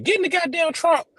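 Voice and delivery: squeaky high mock woman's voice; high falsetto woman impression. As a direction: pitch way up like a woman. Mock woman voice in trunk bit.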